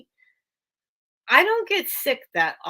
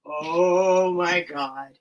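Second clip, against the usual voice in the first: stunned gasp; 5 semitones lower, much slower than usual. Real, not acted. Shocked at something seen.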